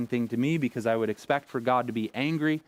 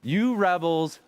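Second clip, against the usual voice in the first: sharp, high and louder "You rebels"; frustrated outburst; 6 semitones higher, 3 dB louder, slower than usual. Retold or imitated. Pastor imitating Moses venting his frustration on the people, a loud angry outburst. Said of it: "I'm like".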